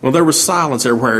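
ordinary delivery

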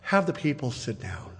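quiet authority settling a crowd; calmly. Retold or imitated. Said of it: "Jesus just very calmly says".

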